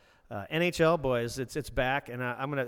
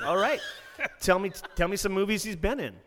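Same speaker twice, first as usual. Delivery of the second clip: challenging retort; 7 semitones higher, 6 dB louder than usual. Retold or imitated. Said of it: "I'm like".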